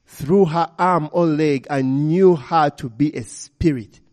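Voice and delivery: plain reading voice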